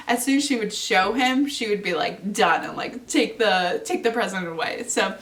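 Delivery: ordinary talk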